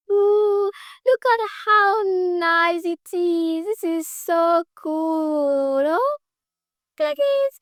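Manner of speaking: high, very slow excited cooing; long, high ooh-and-aah gushing; drawn-out marvelling. Real, not acted. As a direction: stretch every word out high in delight. Marvelling at the chosen design.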